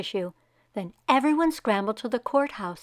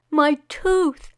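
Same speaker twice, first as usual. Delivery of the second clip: cried; 6 semitones higher, 5 dB louder than usual. Acted. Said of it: "cried Wei".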